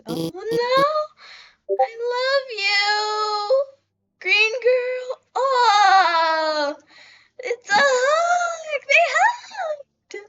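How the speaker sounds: high, drawn-out wail of affection; tearful gushing. Real, not acted. Player watching two characters hug, tearful gushing. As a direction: wail it slowly, overcome by cuteness.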